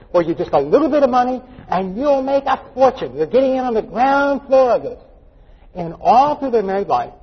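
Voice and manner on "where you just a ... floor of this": higher, sing-song huckster hype; salesman's pitch. Retold or imitated. Mock promoter to investor about making a fortune, hyped-up pitch. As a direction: sell it big, stretching the key words.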